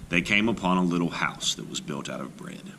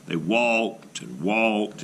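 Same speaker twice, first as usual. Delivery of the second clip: slow; much slower than usual. A narrator's reading. Long journey home.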